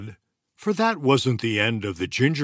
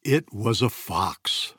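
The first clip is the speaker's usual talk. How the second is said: plain reading voice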